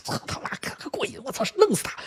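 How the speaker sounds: hushed, voiceless mock glee, rising at the end; secret gloating. Second child relishes sibling's punishment, hushed gleeful gloating. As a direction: gloat under the breath like a scheming child. 老二暗中得意.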